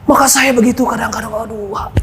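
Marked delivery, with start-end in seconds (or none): 1.3-1.9 breathless whispered groan of weariness; whispered sigh. Retold or imitated. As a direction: sigh it under your breath to God. Sighing prayer in hardship.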